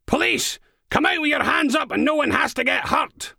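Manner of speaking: very high, loud commanding yell; police shout. A narrator's reading. Steele calls out to the hidden intruder, shouting. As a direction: bellow it up the stairs.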